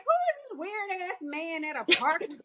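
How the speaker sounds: suspicious disbelief. Retold or imitated. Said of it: "And I'm like".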